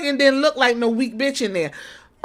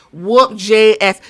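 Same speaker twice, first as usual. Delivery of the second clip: normal talking voice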